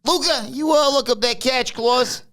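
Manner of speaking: lightly hammed-up boss asking; Tony impression. Tony asking Luca about the clause, high voice. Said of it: "And Luca says".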